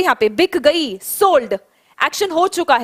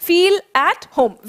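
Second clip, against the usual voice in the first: slow repeat-after-me; 3 semitones higher, much slower than usual. Shown as a demonstration. Teacher leading her class in slow choral repetition. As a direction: feel at home drawn out slowly word by word. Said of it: "मेरे साथ-साथ pronounce करेंगे".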